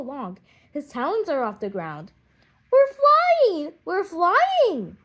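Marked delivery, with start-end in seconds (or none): 2.7-5.0 loud, much higher joyful cry; excited shout. Acted. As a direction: shout it out thrilled at taking off. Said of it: "shout the children in excitement".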